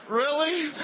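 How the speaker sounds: a disbelieving, delighted yelp; surprised. Retold or imitated. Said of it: "I went".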